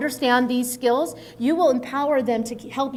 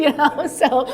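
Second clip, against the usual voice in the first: laughing; 3 semitones higher, 6 dB louder than usual. Real, not acted. Laughing after anecdote.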